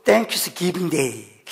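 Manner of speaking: careful English. Shown as a demonstration. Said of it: "제가 밤에 배워서 낮에는 잘 안 돼요".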